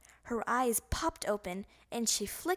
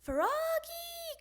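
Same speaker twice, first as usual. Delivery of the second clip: long high call, much higher; mother calling Froggy from far. Acted. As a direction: call him from afar, sung out. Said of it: "called his mother".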